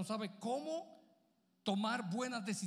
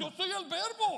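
Jesus, higher and proud; 10 semitones higher, 5 dB louder than usual. Acted. Pastor as Jesus proclaiming to the crowd, higher. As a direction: proclaim it as the Word himself. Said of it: "Pero si tú fueses Jesús".

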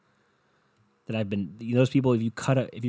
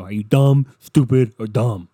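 loud, booming mock taunt from a huge guy; hammed-up imitation of a giant mocking someone; big guy's taunt; 10 dB louder than usual. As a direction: bellow it down at someone like a six-foot-nine bruiser. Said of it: "Unless you're six nine and you just go".

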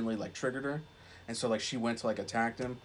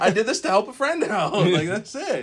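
mock bashful; 10 semitones higher, 11 dB louder than usual. Playing the bashful, flattered friend.

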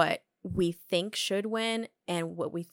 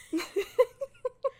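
high laugh. Real, not acted. Laughs at own mock scolding.

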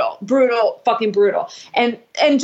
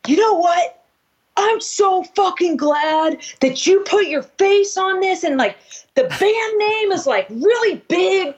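mock yelling; 8 semitones higher than usual. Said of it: "he's just, like, yelling".

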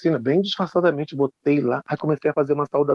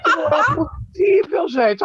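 much higher, slow, laughing through the words; laughing disbelief; 11 semitones higher, much slower than usual. Real, not acted. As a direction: crack up while saying it. Rindo da cena. (rindo, incrédulo)

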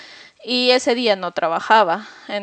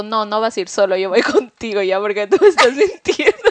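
riéndose mientras acusa de mentir; la amenaza celosa que se le escapa en risa; acusación entre risas; 4 dB louder than usual. Retold or imitated. Ella se impone, acusando entre risas. (novia celosa al chico, imitada riendo)

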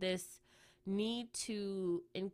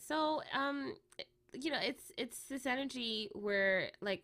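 ordinary delivery

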